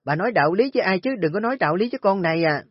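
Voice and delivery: shouted high and fast, raging; loud furious retort. Acted. Daughter-in-law yells back at Nga's mother, loud and fast. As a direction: let the fury explode at her. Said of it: "lớn tiếng nói lại".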